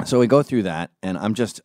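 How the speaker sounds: usual speaking voice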